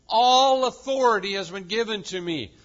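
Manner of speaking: "All" hit hard and loud, falling to the end; stress on ALL. Shown as a demonstration. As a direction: hammer the word "All" home. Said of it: "note those words".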